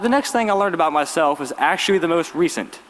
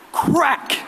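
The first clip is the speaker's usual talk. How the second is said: a sharp, much higher Crack!; chair crack sound; 11 semitones higher than usual. Retold or imitated. Student telling classmates about the chair swing, high crack sound. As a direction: crack it out like the hit itself. Chair hitting the attacker's face.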